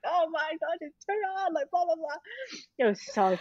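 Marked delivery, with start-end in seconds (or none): 0.1-2.2 high, hammed-up whine of despair; fake-wail it, half laughing; mock whining. Friends mocking the new parents with a high, hammed-up whine. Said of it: "being like".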